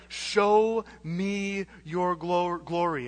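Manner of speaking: slow, hushed Moses asking to see glory; slow reverent plea. Retold or imitated. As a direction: Show me your glo-, glory drawn out very slowly. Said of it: "this is the crescendo".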